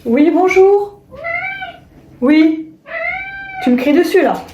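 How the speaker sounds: high, cooing pet voice; talking to a pet. Real, not acted. Greeting the cat.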